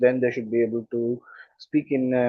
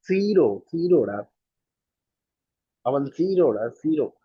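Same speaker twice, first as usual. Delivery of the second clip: put-on Tamil accent, zero said as siro, higher and sing-song; imitating Tamil accent; 4 semitones higher than usual. Podcast guest mocking how Tamil speakers say zero, imitated Tamil accent. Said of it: "Tamil speakers do not pronounce Z sounds properly".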